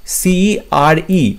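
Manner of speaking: ordinary talk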